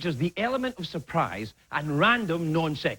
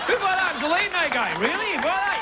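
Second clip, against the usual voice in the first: mock incredulous shout; 13 semitones higher than usual. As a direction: tease the buyer in disbelief. Teasing guest about bad art.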